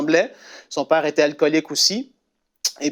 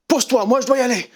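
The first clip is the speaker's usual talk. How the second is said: urgent mother; 8 semitones higher, faster than usual. Retold or imitated. Mother pushing past father.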